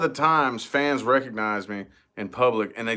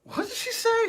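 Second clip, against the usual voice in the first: pitched way up in disbelief; mock a shocked question; high startled quote. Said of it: "whips around out of nowhere and goes".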